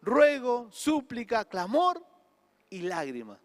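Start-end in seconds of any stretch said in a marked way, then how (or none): 1.5-2.0 clamor lifted high on a rise; emphatic clamor; stressed word. Shown as a demonstration. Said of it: "Miren lo que dice". 2.7-3.4 y lágrimas dropped low and soft; soft, sorrowful lágrimas; hushed. Shown as a demonstration. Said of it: "Miren lo que dice".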